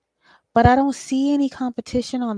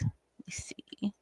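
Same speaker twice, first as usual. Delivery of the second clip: muttered aside; 15 dB quieter, slower than usual. Real, not acted. Muttered to herself while reading.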